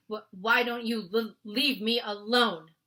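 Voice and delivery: nervous broken stammer; scared giant pleading. Acted. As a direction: stumble over each word in fear. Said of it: "he stammered".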